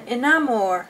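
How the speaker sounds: Inamor with a vaguely Spanish or French lilt; unintended foreign accent. Real, not acted. Vlogger naming an altar to viewers, in an unintended vaguely Spanish accent. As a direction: say Inamor in an accidental Romance-language accent. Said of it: "I always get an accent when I say Inamor".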